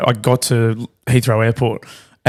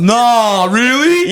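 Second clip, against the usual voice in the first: high-pitched mock-angry sarcasm; flying off the handle; 10 semitones higher, much slower than usual. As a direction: play an exaggerated, high-pitched sarcastic snap. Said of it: "I just fly off the handle".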